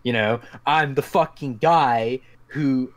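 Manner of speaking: ordinary talk, nothing marked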